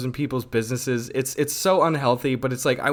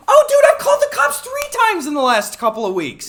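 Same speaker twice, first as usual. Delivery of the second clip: excited; 7 dB louder than usual. Real, not acted. Excited recollection, high pitched.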